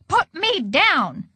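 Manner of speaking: high, slow, restrained yell; indignant yell. A narrator's reading. Edward carried by a fireman, indignant mild yell. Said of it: "yelled Edward".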